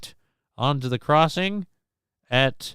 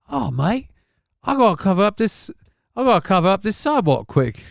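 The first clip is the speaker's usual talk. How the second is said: fast, higher mock Kiwi voice; quick, pitched-up mock New Zealand accent; mock Kiwi vandal; 4 semitones higher, faster than usual.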